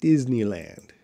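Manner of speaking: "Disneyland" said as a model word; careful pronunciation. Shown as a demonstration. Teacher answers a viewer who struggles with it, careful pronunciation of "Disneyland". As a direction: pronounce "Disneyland" clearly for a learner. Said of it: "I have problems to pronounce Disneyland".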